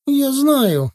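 put-on very high, indignant child's cry; boy's yell. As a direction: yell down as a stubborn little boy. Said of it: "крикнул мальчик". (мальчишеский крик, очень высоко)

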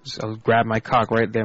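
ordinary talk, nothing marked

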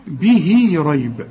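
به ريب very slow with a long hi; joined bihi. Shown as a demonstration. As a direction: stretch به two counts into ريب. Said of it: "عند الوصل". (به ريب بمد حركتين)

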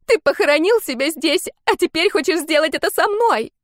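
high, loud despairing shout. Acted. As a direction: hurl it at him. Daughter screams at father.

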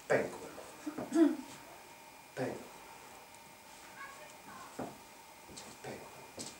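fading to a breathless whisper; hushed confession. Real, not acted. Preacher telling the congregation he broke, near whisper. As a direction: let each word die away.

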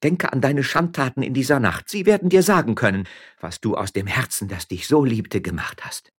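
loud, high outburst, sinking towards the end; beside herself. Acted. Wife accusing her husband of shameful deeds, distraught. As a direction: erupt in distraught accusation, then come down. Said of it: "antwortete die Navareserin außer sich".

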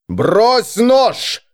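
high, urgent guard's command; shouted order. A narrator's reading. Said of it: "закричал начальник стражи".

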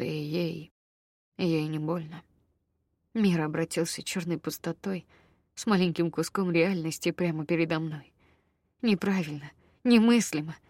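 usual speaking voice

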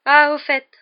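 high, loud call to a pupil; sudden afterthought. Acted. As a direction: call out as if just remembering. Seer calls to departing pupil.

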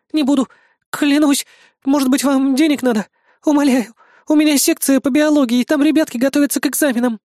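high, anxious woman's voice; young woman pleading for mercy; frightened pleading. A narrator's reading. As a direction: beg in a high, hurried voice. Hostage pleads with gunman.

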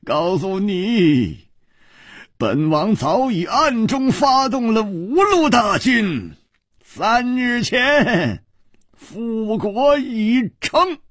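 high, slow grandiose declaration; triumphant king. A narrator's reading. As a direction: draw each phrase out in triumph. King boasts after laughing at foes. (得意洋洋，拖长声音高声宣告)

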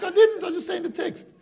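high, put-on voice of innocence; protesting wife. Wife answering her husband's accusation, protesting innocently.

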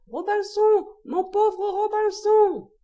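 very high, drawn-out strident wail; parrot crying out. A narrator's reading. Parrot left near the anchor calls its master, crying out. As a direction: stretch it into a piercing parrot cry. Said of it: "en criant de toutes ses forces".

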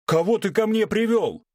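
high, loud panicked yell; panicked shout. A narrator's reading. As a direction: shout at the constable in terror. Менеджер кричит на констебля. (испуганный крик, выше и громче)

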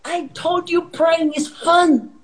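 high, fervent preacher voice; preaching. Real, not acted. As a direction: preach it with rising fire. Preaching at full force.

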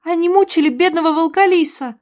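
high, loud indignant cry; angry shout. A narrator's reading. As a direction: shout it back at them. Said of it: "крикнула в ответ Зайна". (гневный крик)

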